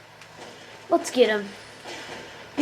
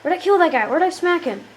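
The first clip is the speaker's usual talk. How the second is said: ordinary delivery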